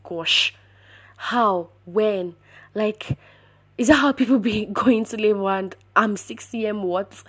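loud bursts of retold shock; shocked disbelief. Patient learning she is already in labor, shocked disbelief.

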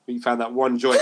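normal talking voice

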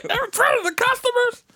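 very high mock disbelief; high-pitched comic shock; appalled reaction. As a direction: retell a scandalized question. Retold shock at swearing.

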